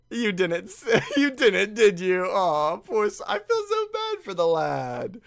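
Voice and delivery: high, loud laughing cry of sympathy; laughing high-pitched lament; laughing disbelief. Real, not acted. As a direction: laugh out your pity for him. Reacting to a scene.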